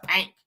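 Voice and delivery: "pink" with an open "a", like "paink"; pink said as "pank". Real, not acted. Vlogger renaming the colour, "pink" said "pank". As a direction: turn the vowel of "pink" into "pank". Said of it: "I gotta call it pink".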